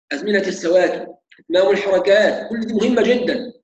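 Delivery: ordinary delivery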